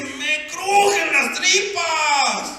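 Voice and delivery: high, slow, groaning complaint; anguished protest. Acted. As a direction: drag it out in acted torment. Husband voices his anguish.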